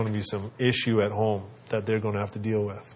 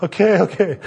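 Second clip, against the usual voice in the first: put-on high, louder kid voice; kid giving in; 8 semitones higher, 6 dB louder than usual. Son agrees to dad's warning in a high kid voice. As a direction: give in fast like a little boy. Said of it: "He's like".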